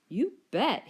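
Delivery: cheerful. A narrator's reading. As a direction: answer gladly. Third dino welcomes brothers.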